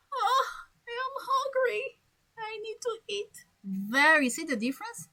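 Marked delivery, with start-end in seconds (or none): 0.1-3.4 high, whimpering wolf crying for food; whiny hungry wolf. Acted. Teacher playing the hungry wolf begging to eat.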